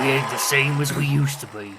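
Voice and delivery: ordinary talk, nothing marked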